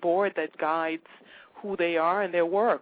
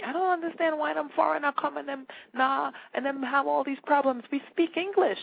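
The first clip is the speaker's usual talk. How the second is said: lightly mimicked Jamaican voice, pitched higher; a hint of a puzzled Jamaican local; 8 semitones higher than usual. Locals scoffing at struggling foreigners, light Jamaican imitation. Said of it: "Jamaicans will say".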